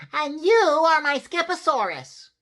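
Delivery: put-on high, loud kitten-boy proclamation. A narrator's reading. Skippy John names his bone model, out loud. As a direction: announce it in a loud, high little voice. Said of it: "he added out loud".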